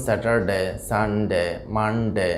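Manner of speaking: স্যাটারডে, সানডে, মানডে with "de" split off; disjointed day names: স্যাটারডে, সানডে, মানডে; syllables said separately. Shown as a demonstration. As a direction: break স্যাটারডে, সানডে, মানডে before a short "de". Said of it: "আলাদা আলাদাভাবে উচ্চারণ করে".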